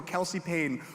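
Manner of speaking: ordinary delivery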